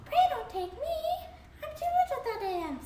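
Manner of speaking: very high, fast begging goat voice; small goat begging not to be eaten; tiny goat pleading. Acted. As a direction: plead in a squeaky little voice. Tiny goat begs the troll.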